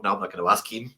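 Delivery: low quiet decision; soft inner thought. Retold or imitated. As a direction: mutter it to yourself low. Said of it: "and I thought".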